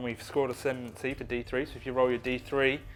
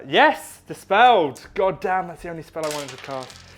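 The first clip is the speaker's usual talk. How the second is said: frustrated outburst; 5 semitones higher, 9 dB louder than usual. Real, not acted. Spell dispelled, frustrated outburst.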